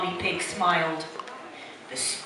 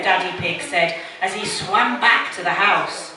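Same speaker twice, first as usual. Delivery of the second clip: plain reading voice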